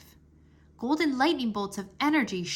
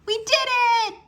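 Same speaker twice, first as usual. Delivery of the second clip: much higher, loud, fast cheer; 14 semitones higher, 10 dB louder, faster than usual. A narrator's reading. Drake cheering as the door lifts open. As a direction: shout it out in triumph. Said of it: "cheered Drake".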